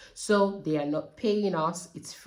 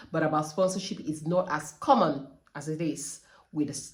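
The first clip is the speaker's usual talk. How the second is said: usual speaking voice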